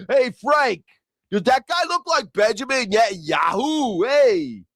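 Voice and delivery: hammed-up New York cop shouting to his buddy; New York accent. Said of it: "you know how they are in New York".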